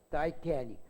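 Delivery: Titanic as tie-TAN-ic, English style; English pronunciation. Shown as a demonstration. The dictionary insists on Titanic, English style. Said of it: "É assim que tem que falar".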